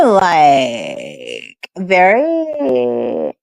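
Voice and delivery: creaky, drawn-out fry on like and very; lazy creaky vocal fry on each word; vocal fry demo. Shown as a demonstration. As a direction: let the voice crackle and drag at the bottom. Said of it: "it's very".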